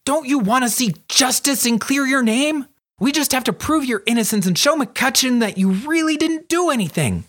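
put-on high-pitched, eager girl's voice; rally your friend in a high excited voice; Charlie, urging. Girl urging her friend to clear his name, high and eager.

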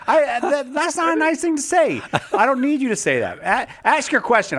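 high, hammed-up wounded indignation; a mock huff at a teasing caller; mock fuming. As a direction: play at being offended, half laughing. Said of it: "I'm gonna fume for a while".

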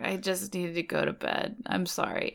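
put-on quiet, sorry drunk mumble; sad drunk. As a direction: fade out like a sleepy sad drunk. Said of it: "like, sad drunk".

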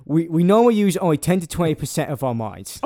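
Cockney quote, done properly; second, fuller Cockney take; Michael Caine voice. Acted. Host restarts the mentalist quote as Michael Caine. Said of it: "Michael Caine it up".

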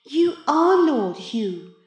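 high, loud, slow pronouncement; smug cat in boy's body. A narrator's reading. The voice repeats the swap to Maurice, gloating. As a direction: drive it home slowly, gloating.

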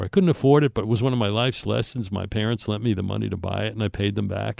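ordinary talk, nothing marked

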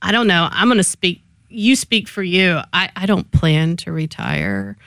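ordinary talk

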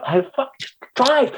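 high-pitched, exasperated urging; exasperated retold order. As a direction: urge him on, at your wits' end.

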